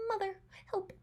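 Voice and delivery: small, high, hushed plea; scared little voice. Pretend. Voicing a scared contestant, a small high plea. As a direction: whimper it like a frightened kid calling for mom. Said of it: "he just looks like".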